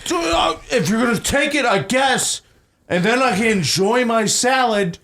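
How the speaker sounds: irate customer, pitched high; retold yelling. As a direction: mimic an outraged diner laying down terms. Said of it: "He's yelling this at me".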